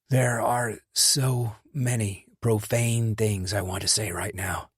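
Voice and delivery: slow, strained, pained delivery; speaking through pain. A narrator's reading. Shot Sean talks to friends, slow and pained. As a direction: push the words out past the hurt. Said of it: "his face twisting as he winced in pain".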